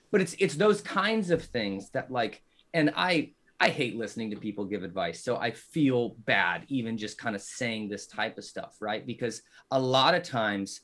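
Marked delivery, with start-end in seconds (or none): none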